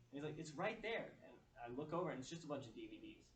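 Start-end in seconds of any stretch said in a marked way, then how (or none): 0.4-1.1 high-pitched imitation of an insistent child; lightly mimicked child; emphatic little son. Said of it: "he's emphatically pointing".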